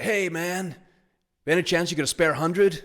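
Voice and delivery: cheeky, easygoing panhandler pitch; imitating homeless man. Homeless man asking money.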